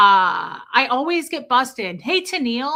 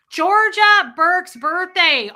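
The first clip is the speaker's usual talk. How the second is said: loud blurt; 9 semitones higher, 9 dB louder, slower than usual. Retold or imitated. Blurting a distraction loudly.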